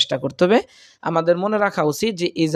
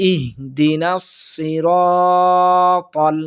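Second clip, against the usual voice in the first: Quran recitation; much slower than usual. Shown as a demonstration. Teacher reciting the verse for students. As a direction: chant the verse slowly.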